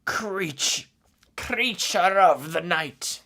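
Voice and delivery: high, loud, slow theatrical vampire voice; Mortis impression. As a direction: draw it out like a grand creature of the night. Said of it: "I'm gonna do my impression of Mortis".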